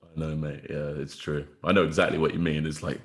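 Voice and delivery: ordinary talk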